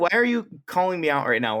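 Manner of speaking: usual speaking voice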